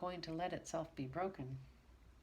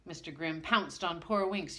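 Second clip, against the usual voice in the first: ordinary delivery, nothing marked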